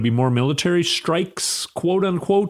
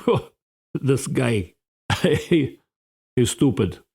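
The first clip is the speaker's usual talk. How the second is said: a Putin-like bad Russian accent, chuckling; mock Putin in a clumsy Russian accent with a laugh; much slower than usual. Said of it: "I can't do a Russian accent very well".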